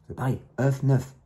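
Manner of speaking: slow, put-on voice for the pun œuf neuf; a mock-comic punchline; joking pun. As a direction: deliver "œuf neuf" slowly as a deadpan gag. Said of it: "C'est du comique que je fais là".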